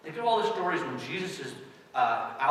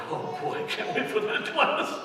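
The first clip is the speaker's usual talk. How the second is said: sarcastic parent; 11 semitones higher, slower than usual. Pretend. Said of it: "your parents are all going".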